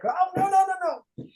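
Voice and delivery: high, loud string of panicked noes; mock alarmed refusal. Imitating people triggered by hearing Jesus, mock alarmed refusal. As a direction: recoil in hammed-up alarm, voice shooting up.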